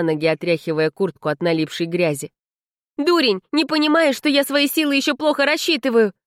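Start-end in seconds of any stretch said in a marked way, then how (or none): 3.0-6.1 high, exasperated telling-off; frustrated, raised-pitch reproach; vexed scolding. A narrator's reading. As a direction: scold him in annoyance. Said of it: "с досадой обругала его Влада".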